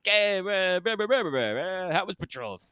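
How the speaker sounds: high, casual guard banter; chatty guard voice. Acted. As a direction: toss off bored guard chatter.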